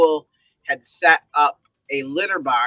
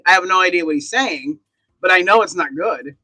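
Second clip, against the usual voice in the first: ordinary talk, nothing marked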